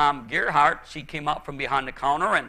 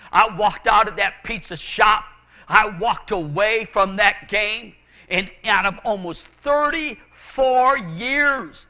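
raised, loud testimony, drawn out toward the end; emphatic preaching; 6 semitones higher, 7 dB louder than usual. Real, not acted. Pastor telling how he walked away from that game, emphatic preaching. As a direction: testify with slow, mounting force.

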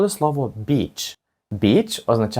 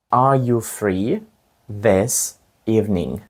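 "Are you free this evening" slowly, in two parts; slow, split in two; slower than usual. Shown as a demonstration. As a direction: break the question in two and go slowly. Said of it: "чи вільний ти цим вечором?".

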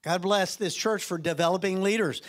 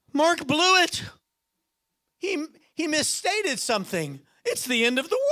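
mock alarm; 7 semitones higher than usual. God pictured as upset when Mark misspeaks, mock alarm. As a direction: overplay the panic. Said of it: "He's not gonna be like".

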